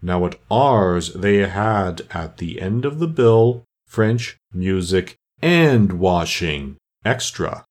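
very slow, drawn-out turtle voice; doleful turtle, self-important. Acted. Mock Turtle boasts of his school's extras, doleful and ponderous. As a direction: list each item slowly and ponderously.

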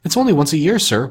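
high, pleading clerk's voice. A narrator's reading. Bob excusing his lateness to Scrooge, pleading. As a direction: plead for leniency with the boss. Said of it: "pleaded Bob".

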